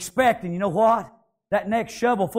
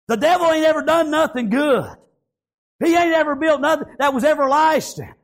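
high, ringing pulpit declaration; raised revival-preacher voice; emphatic preaching; 6 semitones higher than usual. Real, not acted. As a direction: declare it to the congregation, pitched up. Preaches at full force.

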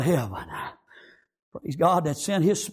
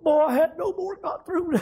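high voice, close to tears; tearful preacher giving testimony; choked up; 8 semitones higher than usual. Real, not acted. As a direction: push the words out through real emotion.

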